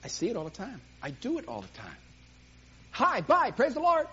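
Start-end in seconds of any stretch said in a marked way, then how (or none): 2.9-4.1 quick, high, loud breezy brush-off; hurried churchgoer greeting on the run; rushed greeting. Retold or imitated. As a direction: toss it off in passing, higher and louder.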